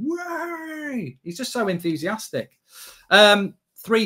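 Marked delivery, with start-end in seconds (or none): 0.0-1.2 a high, whooping cheer; high whoop of pure glee; mock joyful whoop. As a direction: imitate an overexcited guy celebrating beans on toast. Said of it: "runs around the garden with his shirt off going".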